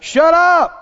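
high, emphatic rebuke; speaking out. Shown as a demonstration. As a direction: order the intruding thoughts to stop. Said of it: "you need to open your mouth and say".

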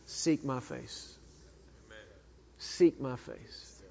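quiet, slow, gentle command; the Lord answering gently; soft voice of God. Acted. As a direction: say it softly and slowly, like a calm answer. Voicing the Lord's answer.

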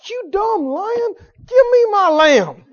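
high, loud, drawn-out acted cry; outraged demand. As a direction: holler it slowly at a thieving animal. Acting David confronting the lion.

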